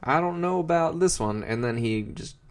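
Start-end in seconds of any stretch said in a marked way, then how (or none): 0.0-1.4 high, doubtful puppet voice; hammed-up dad with doubts. George Senior doubting the new pet, high puppet voice. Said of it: "George Senior's like".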